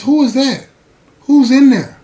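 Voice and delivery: loud, high, alarmed questions; jealous demand. Retold or imitated. Inmate hearing a man in her house, loud alarmed demand. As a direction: demand to know who's there.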